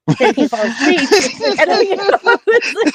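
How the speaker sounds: giddy, breaking into laughter; laughing. Real, not acted. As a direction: laugh while getting the words out. Laughing through the fear story.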